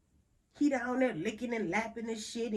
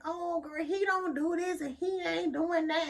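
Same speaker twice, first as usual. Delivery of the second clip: complaining client; 10 semitones higher, 3 dB louder than usual. Retold or imitated. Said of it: "They be like".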